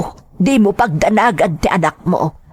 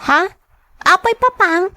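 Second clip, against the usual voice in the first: put-on high child voice, anxious questions; a little girl begging to know why; worried child; 13 semitones higher than usual. Child asks father where he is going.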